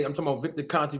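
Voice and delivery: ordinary talk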